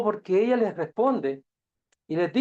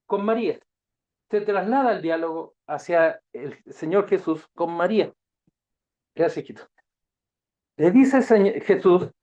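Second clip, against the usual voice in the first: ordinary delivery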